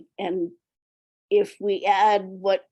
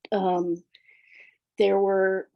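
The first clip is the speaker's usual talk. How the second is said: ordinary talk, nothing marked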